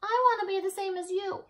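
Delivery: put-on high little child's voice; small person voice. A narrator's reading. Little sibling pleading with Elmore, high and small. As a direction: sound small and eager to please. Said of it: "said the small person".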